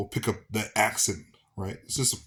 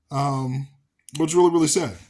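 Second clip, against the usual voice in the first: ordinary talk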